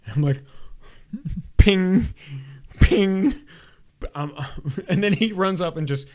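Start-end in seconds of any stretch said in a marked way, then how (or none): 1.6-3.3 higher, louder playful pings while laughing; laughing ping sound effect. Retold or imitated. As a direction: mimic the game's hit sound, giggling. Imitating game hits on son.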